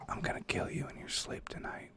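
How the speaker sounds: menacing whispered threat; creepy whisper. Retold or imitated. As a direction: breathe the threat right into his ear. Retelling the kid's threat.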